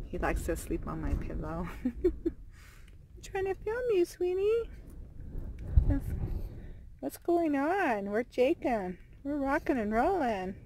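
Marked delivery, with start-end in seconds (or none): 1.8-10.6 talking to a pet. Real, not acted. Cooing at dog on pillow.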